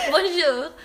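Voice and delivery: high, loud mock French greeting; French accent. Customer greets French shop staff, in a loud French accent. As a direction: push up the pitch on a put-on French accent. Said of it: "putting my, my French, French accent".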